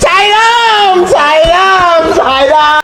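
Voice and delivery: high ringing calls, fading slightly on the last; calling out. Acted. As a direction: keep calling Sairam from afar. Calling out a name again.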